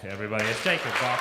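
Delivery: loud, building hype call; hype announcer. Real, not acted. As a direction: sell the name to the crowd. Host hyping the act.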